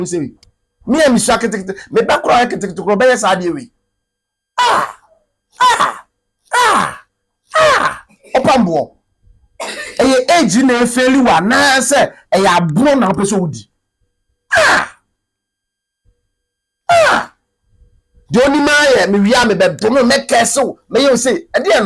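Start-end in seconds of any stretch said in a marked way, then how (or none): 4.6-8.1 high, drawn-out mocking ahs; scoffing high-pitched ahs; mock high cries. Mocking exclamations at the pastor. 14.6-17.3 high, drawn-out mocking ahs; scoffing high-pitched ahs; mock high cries. Mocking exclamations again.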